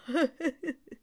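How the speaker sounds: high mocking camel chuckle; a scornful high laugh. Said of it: "and laughed at them".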